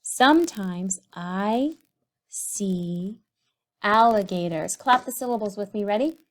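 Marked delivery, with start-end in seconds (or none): 0.0-4.7 very slow, each word drawn out; slow dictation. Shown as a demonstration. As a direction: dictate while writing. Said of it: "started again with a capital letter".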